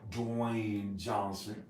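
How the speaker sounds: usual speaking voice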